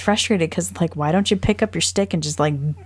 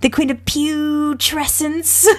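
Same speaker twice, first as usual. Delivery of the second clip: high, slow, drawn-out jeering; high-pitched mock denunciation; booing crone from the dream; 10 semitones higher, slower than usual. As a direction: heckle theatrically, stretching out each insult. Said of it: "the woman's booing her".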